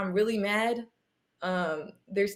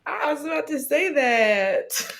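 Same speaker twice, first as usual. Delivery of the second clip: high and slow, laughing through it; laughing along with the joke; 7 semitones higher, slower than usual. Real, not acted. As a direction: giggle the words out.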